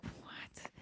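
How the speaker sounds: voiceless, hushed disbelief; stunned whisper. Retold or imitated. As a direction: breathe the word out in retold shock. Retold shock at news of the plane.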